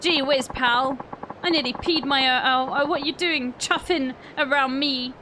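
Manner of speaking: a hint of a rough British lad; British accent. Reading game dialogue in a light British accent. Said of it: "up with this British accent".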